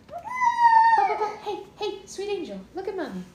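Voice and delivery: very high cooing syllables. Real, not acted. Cooing to baby.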